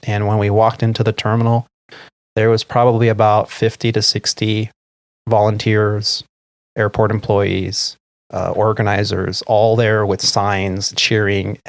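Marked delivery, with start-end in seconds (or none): none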